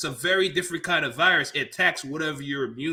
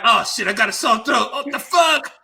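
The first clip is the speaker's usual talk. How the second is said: shouted, high and loud alarm; 7 semitones higher, 5 dB louder than usual. Retold or imitated. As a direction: yell it like you just noticed. Retold alarm at sore throat.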